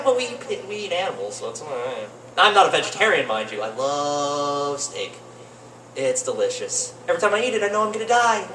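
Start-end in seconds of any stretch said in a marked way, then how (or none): none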